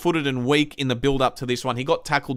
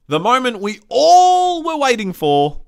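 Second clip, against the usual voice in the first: slow, loud, higher hype; excited build-up; 6 semitones higher, 6 dB louder, slower than usual. Real, not acted. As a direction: stretch it out loud and high for the big moment. Builds up to the awaited goal.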